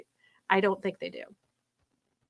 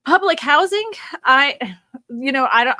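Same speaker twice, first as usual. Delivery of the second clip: emotional outburst; 3 semitones higher, 7 dB louder than usual. Real, not acted. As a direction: erupt with genuine indignation. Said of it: "got a little emotional there".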